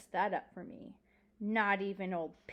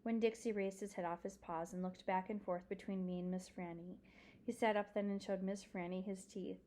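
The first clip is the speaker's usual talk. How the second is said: usual speaking voice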